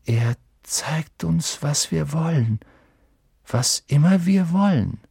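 slowly piecing the answer together; slow, thoughtful. A narrator's reading. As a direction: think aloud slowly, with pauses. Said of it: "Dann sagte er langsam".

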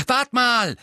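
high, loud interruption; loud interjection. A narrator's reading. Twin cutting off his elder's argument, loud. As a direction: burst in loudly mid-sentence. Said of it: "warf George laut ein".

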